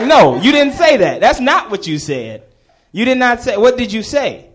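loud, high scolding imitation; mad teacher. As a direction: press the pupil like an angry teacher. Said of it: "And she was mad".

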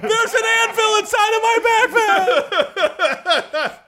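high, laughing recruit yell; barked military recruit reply. Pretend. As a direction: shout back like a scared recruit. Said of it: "Yes, Staff Sergeant".

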